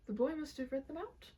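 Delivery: usual speaking voice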